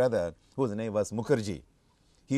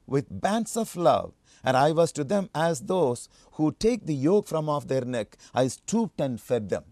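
loud, emphatic scripture reading; 5 dB louder than usual. Acted. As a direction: proclaim the verses to the congregation. Reads scripture aloud to congregation.

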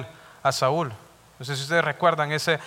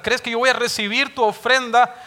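higher, louder, stern rebuke; God rebuking the unfaithful husband, thundering; God's rebuke; 6 semitones higher, 5 dB louder than usual. Acted. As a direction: scold the hypocrite with fire. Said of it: "lo mismo que le está diciendo".

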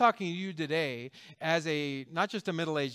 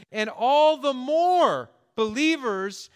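rising preacher fervor; 6 semitones higher, 6 dB louder, slower than usual. Real, not acted. Preaching swell of growth.

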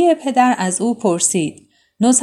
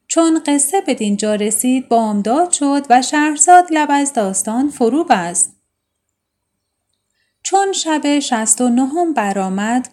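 plain reading voice

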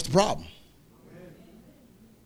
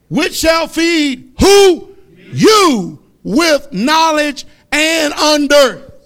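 slow, loud, high shouted preaching; preaching at full force; 7 semitones higher, 6 dB louder, much slower than usual. Acted. Preacher shouting scripture at the brothers, slow and loud. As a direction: thunder the scripture to the congregation.

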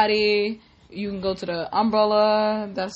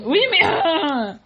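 mock shock; 6 semitones higher, 6 dB louder, slower than usual. Mom mock-upset at child.

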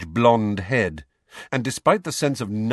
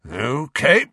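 louder, higher, strained calm; tightly controlled acknowledgement; forced calm; 7 semitones higher, 6 dB louder than usual. A narrator's reading. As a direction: hold exasperation down under a calm word. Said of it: "in a voice of forced calm".

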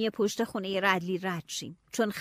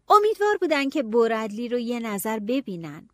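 ordinary delivery, nothing marked

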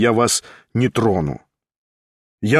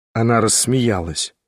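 ordinary delivery, nothing marked

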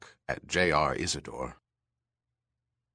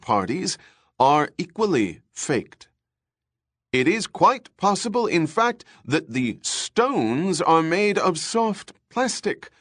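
broadcast expert voice; 8 semitones higher, 4 dB louder than usual. Acted. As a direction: announce it like a TV exposé. TV research chief's report.

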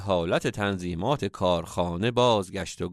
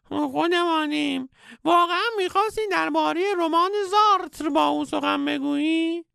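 very high, fast sly question; sly cartoon old master; teasing squeaky sage; faster than usual. A narrator's reading. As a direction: poke fun at him in the guru voice.

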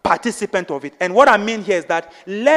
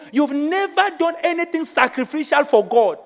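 usual speaking voice

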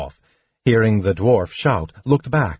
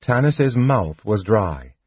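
ordinary delivery, nothing marked

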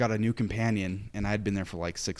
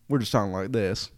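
ordinary talk

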